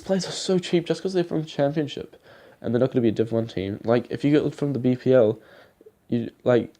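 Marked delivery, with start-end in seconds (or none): none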